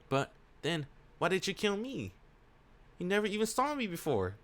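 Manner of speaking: high-pitched, pleading query; questioning woman character. Acted. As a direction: lift the voice high and ask him. Voices the woman questioning her killer.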